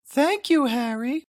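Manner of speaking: a cheerful mom thanking her son; mother's voice. Acted. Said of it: "she said".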